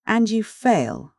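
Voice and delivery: a slow, amused jab back; smiling reply. A narrator's reading. Narrator answering the professor with a smile. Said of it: "I answered, smiling".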